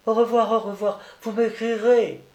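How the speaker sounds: put-on high-pitched little-boy goodbye; child's eager farewell. A narrator's reading. Sick boy parting from his friend, eager farewell.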